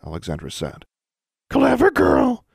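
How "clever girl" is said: very high, loud bird squawk; raven voice. A narrator's reading. Charlie the raven butts into the argument, high squawk. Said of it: "said Charlie".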